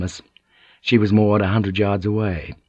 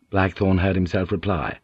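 ordinary delivery, nothing marked

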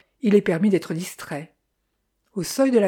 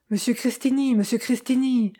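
higher-pitched call, not shouted; 4 semitones higher than usual. A narrator's reading. Detective hurries after Monsieur Cristini in the street, calling out. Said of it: "appelai-je".

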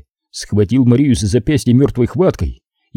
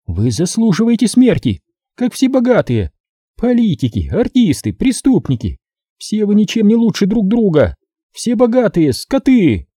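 higher, taunting woman's voice full of contempt; gloating female captor; 10 semitones higher than usual. A narrator's reading. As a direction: sneer it at the rich man, pitched up. Captor's tirade against the rich. (злорадная похитительница, высоким голосом)

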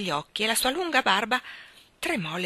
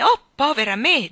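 higher, louder wail on "oh, povera me"; louder wailing lament; 6 semitones higher, 9 dB louder than usual. Acted. Queen despairing over the king, louder wail. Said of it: "gemette la regina".

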